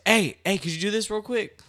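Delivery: loud, high call across the room. Retold or imitated. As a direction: raise the voice to get attention quickly. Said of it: "louder than this".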